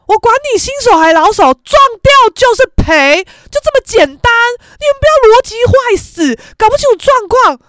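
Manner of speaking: loud, high, emphatic scolding; acted exasperated telling-off; indignant rant. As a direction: lay down the law at full volume. Narrator's indignant imagined retort.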